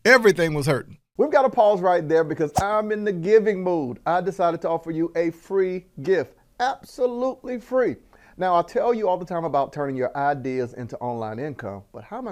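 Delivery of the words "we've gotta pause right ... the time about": bright higher ad pitch; ad read. Acted. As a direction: pitch the freebie. Inserted promo segment.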